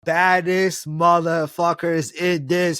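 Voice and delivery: slow, relished, emphatic praise; drawn-out hype. Real, not acted. Fan hyping Chuck Norris to a co-host, drawn out and emphatic. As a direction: stretch every word out slowly for emphasis.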